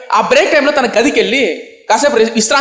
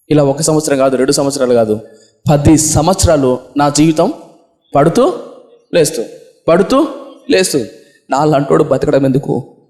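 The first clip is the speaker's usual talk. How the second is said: much lower, slow hopeless lament; low despair; 6 semitones lower than usual. Acted. A youth telling his pastor of ten years' failing, low and hopeless. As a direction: sink low, heavy with despair.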